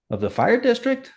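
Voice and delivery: retold disbelief, pitch jumps way up; stunned, half-shouted question at the news; incredulous. Chief retelling the board president's call, incredulous.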